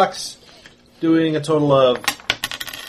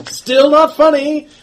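mocking outlaw; 5 semitones higher, 6 dB louder than usual. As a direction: sneer at the failed joke. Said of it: "And he says".

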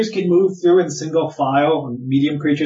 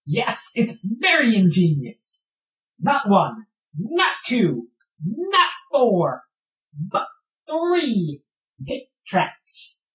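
slow, higher proud little voice; a higher, slower boastful creature; proud kobold; 5 semitones higher, slower than usual. Acted. Proud kobold boasting about traps.

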